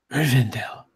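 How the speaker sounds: voiceless, awed whisper of the name; breathless whisper. Acted. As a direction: breathe the word out in wonder. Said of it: "Baralash would just breathlessly say".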